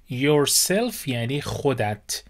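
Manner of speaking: ordinary delivery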